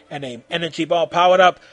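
usual speaking voice

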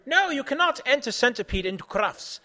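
high, loud objection from a judge; shouted refusal. A narrator's reading. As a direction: shout it as an outraged ruling. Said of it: "shout just".

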